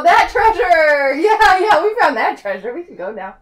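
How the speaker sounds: normal talking voice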